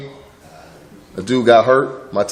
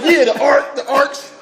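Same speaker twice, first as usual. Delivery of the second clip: mock excitement; 10 semitones higher, slower than usual. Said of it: "We was like".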